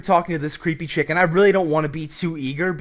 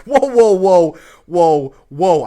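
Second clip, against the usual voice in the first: loud whoa; 3 semitones higher, 10 dB louder, much slower than usual. Retold or imitated. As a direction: shout her down to stop her right there. Backing off from videotape request.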